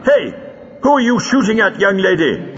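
high-pitched, affronted shout across the room; indignant call. A narrator's reading. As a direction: call out in outraged protest. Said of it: "called Lightfoot indignantly".

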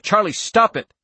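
high, loud urgent command; shout. A narrator's reading. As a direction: shout to make the child stop. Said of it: "Andy shouted".

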